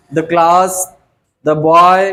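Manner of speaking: put-on the class, the boy, with "the" said "duh"; teacher demonstrating "the" as "duh" before class and boy. Shown as a demonstration. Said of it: "कहाँ द को द sound pronounce करते हैं".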